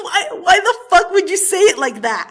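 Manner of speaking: loud, high outburst through laughter; laughing disbelief. Real, not acted. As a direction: exclaim incredulously while cracking up. Ridiculing his confession, breaking up laughing.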